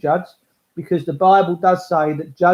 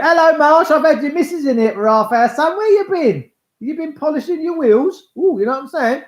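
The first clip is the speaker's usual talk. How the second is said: high teasing mate's voice, much higher; mock a pal ribbing his friend, pitched up high; mimicking Jason Wills; 10 semitones higher than usual. Imitating Jason Wills teasing Marsh, high voice. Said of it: "as Jason Wills just said".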